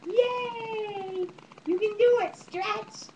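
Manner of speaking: high, eager coaxing; encouraging cheer. Real, not acted. Encouraging baby.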